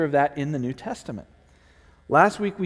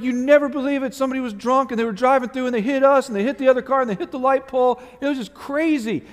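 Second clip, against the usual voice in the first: excited cover story; 7 semitones higher, 3 dB louder, faster than usual. Retold or imitated. Kids lying about the crash.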